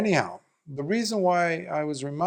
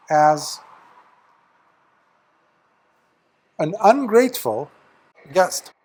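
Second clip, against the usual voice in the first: louder, firm reproach to a monk; stern rebuke; 5 dB louder than usual. Retold or imitated. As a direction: lay down the rebuke firmly.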